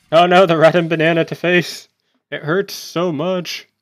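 high-pitched, hammed-up wail of dismay; mock agony. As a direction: play the wounded victim, drawing it out. Joking dismay at banana attack.

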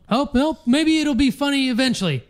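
high-pitched mock quote; mocking the friend. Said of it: "you just keep going".

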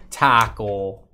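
"taco" stretched slow in a fake Canadian accent, like "tackle"; exaggerated Canadian "taco" with a short a; mock Canadian accent. Shown as a demonstration. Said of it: "That's how they say it in Canada".

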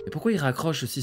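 normal talking voice

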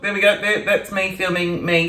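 usual speaking voice

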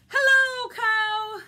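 high, loud storybook duck hailing a friend; calling out a greeting. Acted. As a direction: sing out a big hello as the duck. Duck calls to Cow in story.

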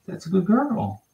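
high sing-song praise for a pup; high cooing praise; puppy praise. Real, not acted.

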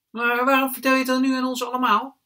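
put-on high, loud child's question; puzzled child. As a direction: call out a challenge in a high child voice. Charlie questions the narrator.

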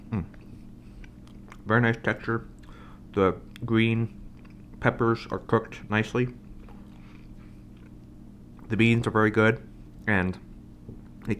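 chewing while talking; talking between bites of fries; mouth full. Real, not acted. Seen 2: takes fries at -1.0, then talks with mouth working.

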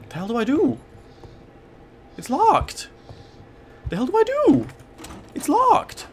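rising panic. Real, not acted. Trapped in locked room, panicking.

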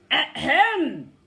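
loud booming throat-clear; rumbling ahem. Acted. Lion in the scrub announcing himself with a loud booming ahem. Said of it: "A rumbling voice came from the scrub".

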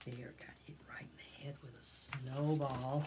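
hushed whisper. A narrator's reading. Reading to children, whispering that Bear got hit. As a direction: whisper the mishap. Narrating a mishap.

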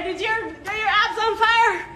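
high and loud, pushing through crunches; straining mid-workout. Real, not acted. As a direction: call it out through the effort. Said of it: "My abs are on fire".

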